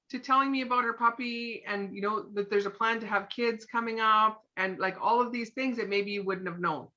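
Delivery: usual speaking voice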